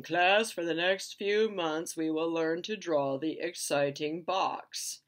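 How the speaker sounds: slow, loud lecturing professor; slow, loud pompous professor; stuffy professor. Retold or imitated. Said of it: "the professor is saying".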